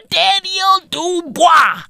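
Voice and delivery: high, slow, shouted Frenchified Daniel Dubois; mock French pronunciation. Shown as a demonstration. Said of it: "That's fucking French".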